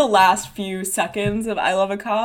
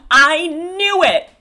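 high, loud, drawn-out triumph; slow, theatrical "caught you" cry; Dora's accusation; 7 semitones higher, 6 dB louder, much slower than usual. Acted. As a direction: pounce on your friend's slip, stretching it out. Dora accuses Marie in scene.

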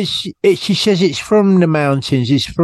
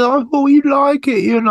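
imitating Kim; 12 semitones higher than usual. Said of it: "she said".

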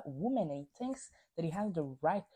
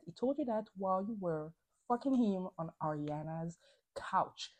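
ordinary talk

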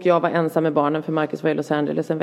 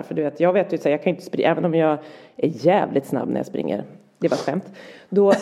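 ordinary talk

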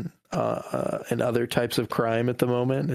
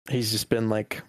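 usual speaking voice

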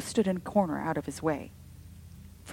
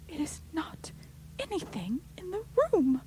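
high whisper of obstinate horror; horrified whisper; 12 semitones higher than usual. A narrator's reading. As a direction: whisper it stubbornly, terrified. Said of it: "with obstinate horror".

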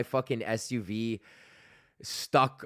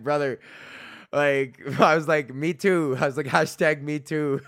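laughing between the words, higher and louder; laughing hard; 4 semitones higher, 4 dB louder than usual. Retold or imitated. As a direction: crack up while quoting yourself. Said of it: "I was laughing so hard".

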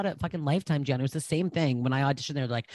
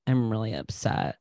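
deadpan low murmur of an upset story; impression of calm friend; 8 semitones lower than usual. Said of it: "impression of me".

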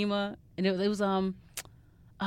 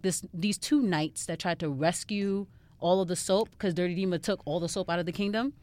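ordinary talk, nothing marked

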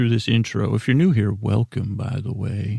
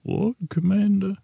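even higher and softer; a high, thin mock Stannis; botched Stannis; 9 semitones higher, 3 dB quieter than usual. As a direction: squeak the two words, a Stannis gone wrong. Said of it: "that didn't even sound like you".

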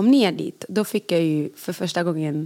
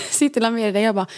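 ordinary talk, nothing marked

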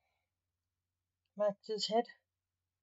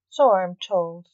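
offhanded; 9 dB louder than usual. A narrator's reading.

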